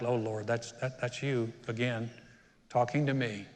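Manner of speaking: low, quiet aside to God; subdued retold prayer; resigned prayer. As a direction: own up to the Lord under your breath.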